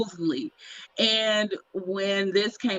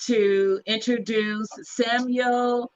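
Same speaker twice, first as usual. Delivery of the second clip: announcer introduction; 5 semitones higher than usual. Real, not acted. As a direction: present the guest with stretched-out name. Formally introducing the new co-host.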